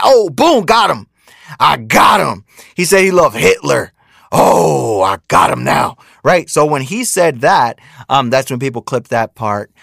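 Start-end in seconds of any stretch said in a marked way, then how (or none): 0.0-6.0 mock gloating. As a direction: sneer it like a smug online critic who caught him. Mocking people who clipped [name].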